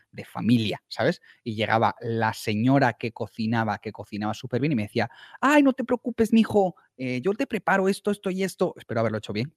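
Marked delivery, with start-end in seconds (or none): 5.4-8.7 put-on high, sing-song Mexican accent as the kindly cook. Family restaurant cook reassuring the speaker, in a Mexican accent. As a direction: imitate a sweet Mexican señora in a Mexican accent. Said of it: "si he hecho el acento mexicano".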